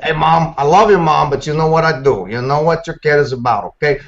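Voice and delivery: lightly voiced tough guy offering a favor; a hint of a streetwise fixer, pushy and reassuring; Sergeant Mayfield voice. Voice actor doing a requested character for the hosts, a light tough-guy fixer. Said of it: "Sergeant Mayfield".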